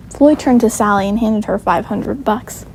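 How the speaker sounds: plain reading voice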